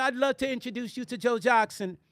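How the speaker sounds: lightly posed British lady; British accent. Retold or imitated. Woman offering an introduction to [name], mock British accent. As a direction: imitate her British accent. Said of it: "she had a British accent".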